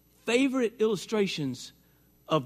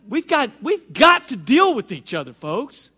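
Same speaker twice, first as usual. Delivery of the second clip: forceful plea; 2 semitones higher, 6 dB louder than usual. Real, not acted. Preaching climax.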